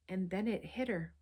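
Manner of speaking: usual speaking voice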